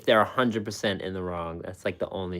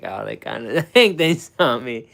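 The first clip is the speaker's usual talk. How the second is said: laughing; 4 semitones higher, 11 dB louder than usual. Retold or imitated. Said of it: "and I'm like".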